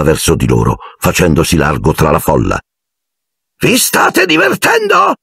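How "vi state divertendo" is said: very high, slow eager question; theatrical party host voice; ghostly host. A narrator's reading. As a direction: call it out to your guests, high-pitched. Ghost host greets his guests.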